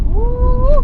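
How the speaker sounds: high joyful whoop; excited whoop. Real, not acted. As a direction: let out a loud whoop. Excited cheer on the road.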